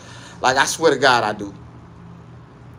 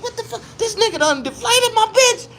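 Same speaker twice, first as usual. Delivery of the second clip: high shocked cry. Retold or imitated. Shocked at deflated doll.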